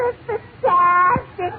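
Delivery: very high-pitched, drawn-out wonder; hammed-up awestruck newcomer; amazed Irish immigrant. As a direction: marvel in a high, monotone comic wail. Irish immigrant marvels in joke.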